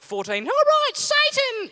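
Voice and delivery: high, loud mock yell of Satan; hammed-up defiant yell; mock shout. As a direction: shout it like a rebellious teen. Teen mocking Satan shout.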